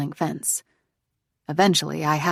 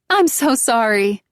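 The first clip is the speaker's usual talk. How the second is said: higher, louder, slow apology; apologetic woman; 5 semitones higher, 7 dB louder, slower than usual. Acted. Lost driver apologizing through window, loud and slow. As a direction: call an apology over the rain. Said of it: "she says".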